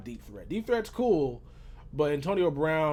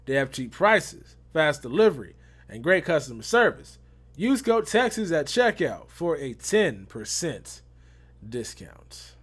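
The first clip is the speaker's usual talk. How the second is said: ad read; 4 dB louder, slower than usual. Acted. Sponsor ad read.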